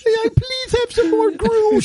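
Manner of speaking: put-on high, slow begging child voice; pleading orphan kid. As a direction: beg like a hungry little orphan. Kids begging for more music.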